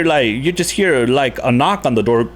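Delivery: usual speaking voice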